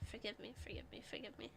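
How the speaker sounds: hushed playful apology; mock plea. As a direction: beg forgiveness under the breath. Apologising for bad accent.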